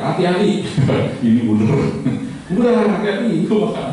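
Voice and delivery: hammed-up warning, half laughing; mock fearful cry. Fifth blind man grabbing the trunk, laughing mock panic.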